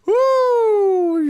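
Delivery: high, loud whoop; excited cheer. Real, not acted. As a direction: let out a big whoop. Streamer cheers.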